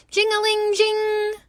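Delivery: high sung phone ring. A narrator's reading. Narrator ringing the telephone.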